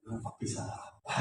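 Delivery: quick hushed whisper. Retold or imitated. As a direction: whisper it softly into a child's ear. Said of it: "Yang selesai saya bisikin". (berbisik pelan dan cepat)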